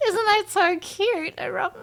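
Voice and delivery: gushing cute. Real, not acted. Gushing over otter babies.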